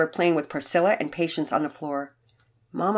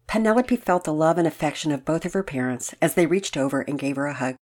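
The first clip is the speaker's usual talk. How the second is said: ordinary delivery, nothing marked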